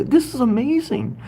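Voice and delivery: "This is amazing" pitched high and gushing; excited amazement. Real, not acted. Host amazed at a viewer from Alaska, gushing high.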